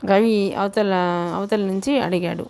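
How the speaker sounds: plain reading voice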